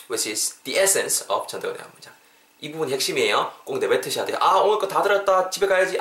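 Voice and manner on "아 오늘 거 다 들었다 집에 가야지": higher mock student who thinks class is over; acted "I'm done, off home" voice; student heading off. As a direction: imitate a listener happily calling it a day. Mimics student wanting to leave early.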